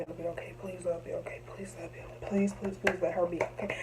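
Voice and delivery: soft, breathless prayer under the breath, begging it to be okay; pleading softly, near a whisper, holding back tears; desperate hushed pleading. Retold or imitated. Waiting by the wrecked car, begging softly that her sister is okay. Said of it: "I wasn't crying, but I was just like".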